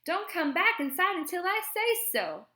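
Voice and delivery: high, loud angry shout. A narrator's reading. Aunt banishing kids to the porch, shouting. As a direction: yell an order after the children. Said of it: "Aunt Minnie shouts".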